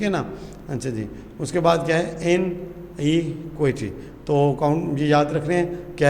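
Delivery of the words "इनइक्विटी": इनइक्विटी slowly, with breaks between syllables. Shown as a demonstration. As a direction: break इनइक्विटी into unhurried separate beats. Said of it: "वो उसका syllable कहलाएगा". (इनइक्विटी के syllable अलग-अलग, आहिस्ता से)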